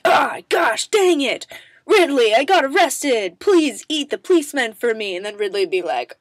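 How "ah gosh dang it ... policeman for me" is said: louder and higher pleading; frantic pretend phone call to Ridley; hammed-up plea for rescue; mock panic call. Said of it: "because be like".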